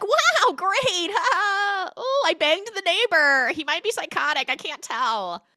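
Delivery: very high, loud false enthusiasm; mock manic cheer. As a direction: babble brightly over hidden panic. Retold frantic fake enthusiasm hiding panic.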